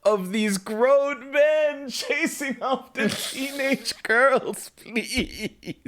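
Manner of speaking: high, drawn-out exasperated protest; exasperated plea. Real, not acted. Podcaster fed up with men chasing teenage girls, exasperated. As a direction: stretch the words out in fed-up pleading.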